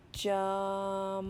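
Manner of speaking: "Jumping" drawn out on one level pitch; slow word. Shown as a demonstration. Teacher says the word for learners to guess, slowly. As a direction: stretch "Jumping" slowly, holding the vowel and m. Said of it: "going to say the word slowly".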